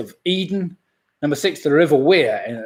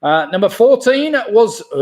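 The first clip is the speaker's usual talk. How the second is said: ordinary delivery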